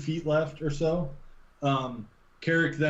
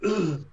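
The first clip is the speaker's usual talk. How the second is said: short voiced "ugh" as if landing hard; grunt of impact; 4 semitones higher than usual. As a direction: grunt like you hit the ground on your back. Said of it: "fall right on your back with a".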